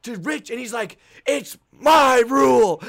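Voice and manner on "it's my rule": loud, high, drawn-out yell; booming, stretched-out comeback; shouted retort. Retold or imitated. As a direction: roar it back to assert authority. Head ref yells back at Rich.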